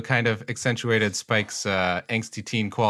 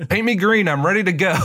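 high, loud, gung-ho; raring to go, pitched up; eager actor voice; 11 semitones higher, 5 dB louder, faster than usual. Retold or imitated.

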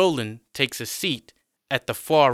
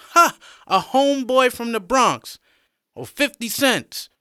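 mocking; 12 semitones higher, 5 dB louder than usual. John mocks Roland's Bronx claim.

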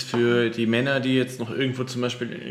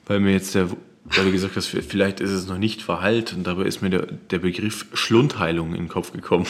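ordinary talk

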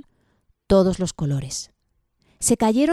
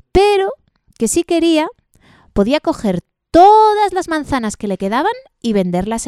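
eager offer; 8 semitones higher, 5 dB louder, faster than usual. A narrator's reading. Tree eagerly offers apples.

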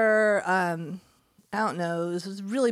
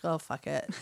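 retold resignation; 8 semitones lower, 9 dB quieter than usual. Retells giving up on accent.

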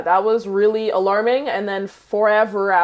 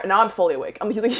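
normal talking voice

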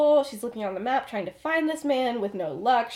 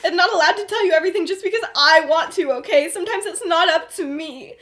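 louder and higher, laughing through the rant; hammed-up heated rant; half-laughing loud outburst; mock shouting; 7 semitones higher, 8 dB louder than usual. Heated argument between friends.

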